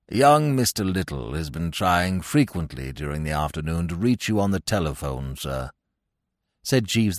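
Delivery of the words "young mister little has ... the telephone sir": low, even, deferential butler's voice; imperturbable valet. Acted. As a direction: report it formally, deep and composed. Plays imperturbable valet Jeeves.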